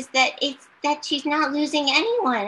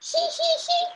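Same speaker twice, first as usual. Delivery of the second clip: high, light hee hee giggle; imitated giggle. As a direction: giggle the way a little kid would. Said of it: "that's how you giggle".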